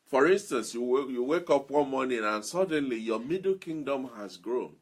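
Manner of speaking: ordinary delivery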